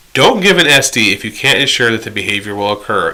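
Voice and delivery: ordinary talk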